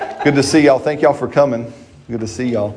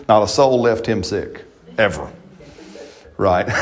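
normal talking voice

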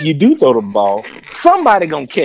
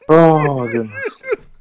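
laughing; much slower than usual. Real, not acted. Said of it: "laughing at the joke".

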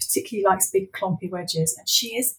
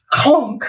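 clonk; 7 semitones higher, 6 dB louder than usual. Acted. Door kicked open.

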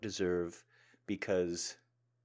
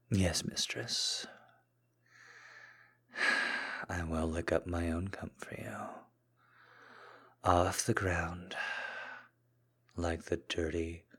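submissive voice; slower than usual. Acted. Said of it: "the more submissive side of me".

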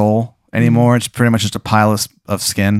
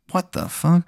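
put-on confused boyfriend; 6 semitones higher, 7 dB quieter than usual. Boyfriend voiced again, confused.

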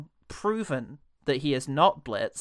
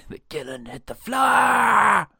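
loud, higher, slow triumphant shout; 8 semitones higher, 6 dB louder, slower than usual. Pretend. To his co-host, topping the joke with a shout. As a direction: bellow the punchline like a chant.

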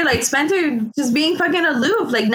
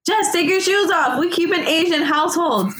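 raised pitch, hurried-after scolding quote; mock calling out a house rule, higher; calling after someone; 6 semitones higher than usual. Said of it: "running after her, like".

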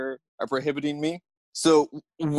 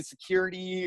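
higher, softer mock call; retold yell; 6 semitones higher, 5 dB quieter than usual. Victim yells for security, softened mock yell. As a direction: imitate calling for help without volume. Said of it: "And I'm yelling".

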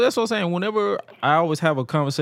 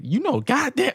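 much higher, brash Bronx god sister; 13 semitones higher than usual. Retold or imitated. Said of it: "She'd be like".

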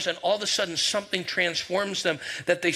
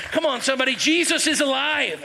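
rising, louder call to the crowd; preaching shout; 7 semitones higher, 4 dB louder than usual. Real, not acted. Preacher to congregation, building to a shout. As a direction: whip the room up: Jesus is alive.

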